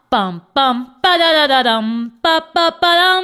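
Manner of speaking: loud sung suspense drumroll; mock dramatic drumroll; vocal drumroll. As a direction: build suspense with a hammed-up drumroll. Suspense drum sound effect.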